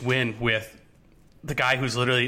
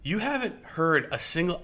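usual speaking voice

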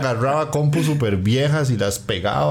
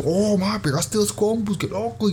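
amazed; 9 semitones higher, slower than usual. Retold or imitated. Mimicking amazement at old networking.

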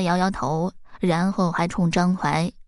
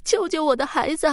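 woman in labour begging, higher by the end; mild hoarse plea, rising pitch; 6 semitones higher than usual. A narrator's reading. Dying mother begs Zhang Huai to save her child, mild hoarse plea. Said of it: "沙哑着声音说".

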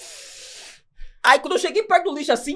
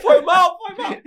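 higher, laughing sorry; laughing apology; 7 semitones higher, much slower than usual. Real, not acted. (desculpa rindo)

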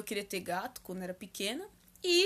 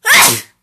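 sudden loud high sneeze; 13 semitones higher, 25 dB louder than usual. Real, not acted. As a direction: let a sneeze burst out. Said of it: "comecei a espirrar".

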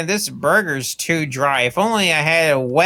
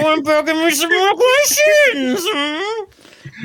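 much higher, crying shout; 13 semitones higher, slower than usual. Real, not acted. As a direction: yell it in mock despair. Shouting protest.

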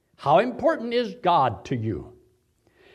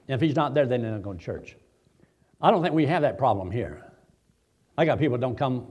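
usual speaking voice

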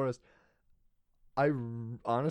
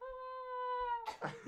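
mock Bigfoot call; 13 dB quieter than usual.

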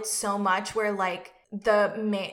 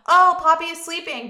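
mock innocent friend; 8 semitones higher, 10 dB louder than usual. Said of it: "she goes to answer it and is like".

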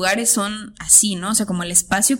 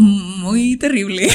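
ordinary talk

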